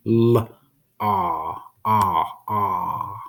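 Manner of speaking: L, then a drawn-out "ah" repeated; sounding out L and ah. Shown as a demonstration. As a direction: isolate the "ah" sound of lost, hold it. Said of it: "You hear the next sound?".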